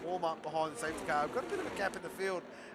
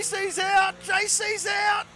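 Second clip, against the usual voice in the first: high, loud, stretched-out shout; real stunned shout; excited shout; 13 semitones higher, 10 dB louder, much slower than usual. Real, not acted. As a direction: yell it out as the car crashes out. Race incident live on stream.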